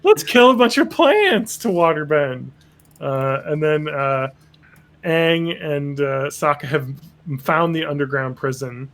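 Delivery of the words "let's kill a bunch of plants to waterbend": high, giggling cheerful voice; laughing mock Katara. Katara cheering on plant killing, high with laughter. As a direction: laugh through it gleefully.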